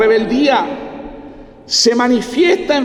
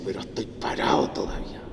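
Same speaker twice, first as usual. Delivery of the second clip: soft whispered defiance; inner defiant voice; 12 dB quieter, faster than usual. Retold or imitated. The child sits but defies his father inside, whispering. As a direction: whisper it to yourself, still defiant. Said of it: "por dentro está diciendo".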